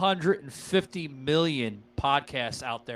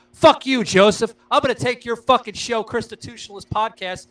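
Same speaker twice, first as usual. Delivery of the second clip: hammed-up yell, higher and louder; mock trash talk; 9 semitones higher, 7 dB louder than usual. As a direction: shout the fake threat at a rival. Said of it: "not being an asshole and saying".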